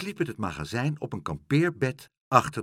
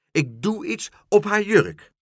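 high, loud triumphant cry; louder exclaiming; 10 semitones higher, 7 dB louder than usual. A narrator's reading. Dolly the pigeon expanding her plan, loudly exclaiming. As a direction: top it with a bigger boast. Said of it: "riep Dolly".

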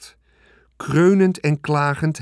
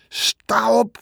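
much higher, louder shout; 11 semitones higher, 4 dB louder than usual. A narrator's reading. Wilbur yelling at the lounging rat, angry shout. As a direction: yell it at the lazy rat. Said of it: "schreeuwde Wilbur".

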